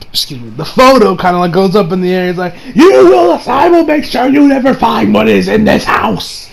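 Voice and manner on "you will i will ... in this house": defiant villain. Retold or imitated. Retelling trapped Kira's dad threatening the heroes, defiant. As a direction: start at a high shout and vow to thwart them.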